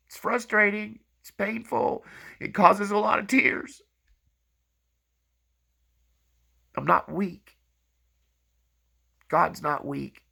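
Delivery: straining against tears; near tears. Real, not acted. Speaker tells viewers of being hurt while helping, tearful.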